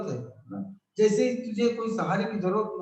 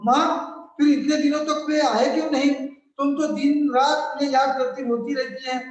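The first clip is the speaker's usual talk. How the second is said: put-on higher, louder child voice asking innocently; a small boy puzzled why his father stays away; little boy Rahul; 5 semitones higher, 6 dB louder than usual. Child Rahul asks his mother about father, higher and louder.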